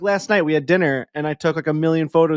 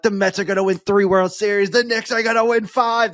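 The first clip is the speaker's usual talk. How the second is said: higher, excited fan voice with pitch climbing; hyped fans; 7 semitones higher than usual. Retold or imitated. Family predicting titles into the camera, excited high voice. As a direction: boast like a giddy New York superfan. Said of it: "They're like".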